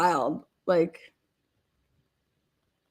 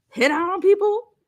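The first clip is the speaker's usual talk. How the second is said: higher, incredulous question; 12 semitones higher than usual. Retold or imitated. Asking about the hitman claim, incredulous. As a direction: ask it in shock. Quoting disbelief.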